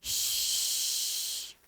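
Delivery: "श" as a soft voiceless hiss; sh sound. Shown as a demonstration. Teacher shows the children the sound: voiceless "श". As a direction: hiss out a long "श" for the class. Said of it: "sound होता है श".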